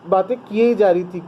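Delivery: ordinary talk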